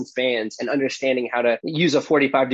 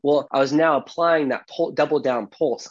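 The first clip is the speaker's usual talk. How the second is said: normal talking voice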